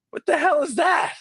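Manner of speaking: high, sharp mock outrage; mock annoyed producer; incredulous. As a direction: stop the singer cold. Producer interrupts angrily.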